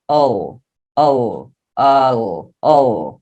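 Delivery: low, drawn-out "O" as the vowel sound of a final L. Shown as a demonstration. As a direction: repeat a long, low "O", gliding like "ol". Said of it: "O L tem som de quê?". ("O" prolongado, o som de vogal do L)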